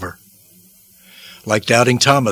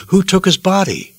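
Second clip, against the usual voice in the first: pitched up on the repeat, demanding an answer; urgent demand; 7 semitones higher than usual. Acted. Women at the tomb question who took the body, demanding. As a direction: demand to know, rising in urgency. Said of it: "they demanded to know".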